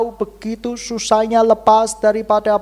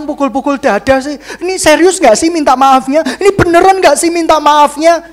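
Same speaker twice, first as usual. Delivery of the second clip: higher, louder mock indignant whine; offended drama seeker; 6 semitones higher, 7 dB louder than usual. Congregant mocked for wanting a dramatic apology, indignant whine. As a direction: whine like someone cheated of a show.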